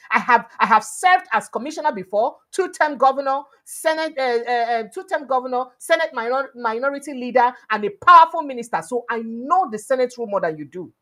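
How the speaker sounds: imitating an angry shout; mock shouting. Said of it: "he dey shout".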